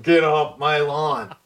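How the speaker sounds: slow, drawn-out grumpy mumble; Clint Eastwood. Acted. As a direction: growl it slowly like a crotchety neighbor. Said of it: "like Clint Eastwood, mumbling".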